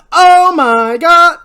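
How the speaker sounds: high, very loud, drawn-out shriek; full-volume wail of shock; horrified scream. Acted. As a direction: scream each word long in horror. Julie horrified, killed a man.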